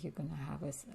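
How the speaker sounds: soft hushed false start; quiet start. Shown as a demonstration. Telling her daughter, a soft hushed false start. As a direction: trail off quietly mid-word. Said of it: "I'm like".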